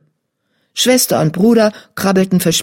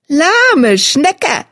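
teasing; 11 semitones higher, 3 dB louder than usual. A narrator's reading. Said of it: "foppte sie ihn".

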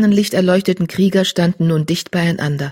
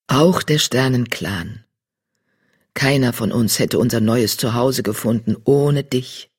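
deep tom voice; 5 semitones lower than usual. Acted. Riesenstern speaks.

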